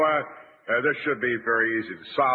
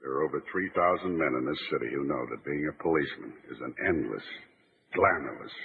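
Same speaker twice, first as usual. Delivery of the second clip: lower, grave documentary narrator voice; solemn police monologue; 5 semitones lower than usual. Acted. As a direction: deliver it like a sincere tribute to cops. Sombre police recruitment speech.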